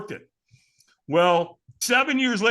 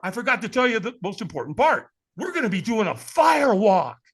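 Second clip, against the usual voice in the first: much higher, urgent afterthought; sudden afterthought; 7 semitones higher than usual. Retold or imitated. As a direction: catch them before they hang up. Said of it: "He goes".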